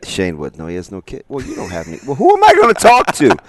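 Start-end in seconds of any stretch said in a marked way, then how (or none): 2.1-3.3 exasperated outburst. Real, not acted.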